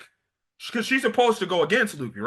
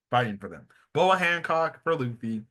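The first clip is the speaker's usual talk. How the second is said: ordinary delivery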